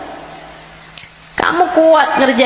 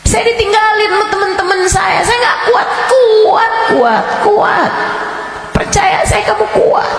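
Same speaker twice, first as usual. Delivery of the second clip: fiery preaching; 11 semitones higher than usual. Acted. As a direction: thunder the warning of the grave. Preaching about grave punishment. (ceramah berapi-api dengan suara tinggi dan keras)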